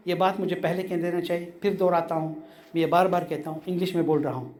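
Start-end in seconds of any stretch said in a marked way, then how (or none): none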